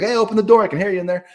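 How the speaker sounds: loud, high call through a closed door. Retold or imitated. Calling to his girlfriend behind her apartment door, loud and high. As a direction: shout to be let in, half-retold.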